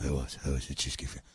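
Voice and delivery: very low, dull confession; deep lumbering suspect caught out; dopey executive. Pretend. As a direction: sheepishly own up to the free dinner.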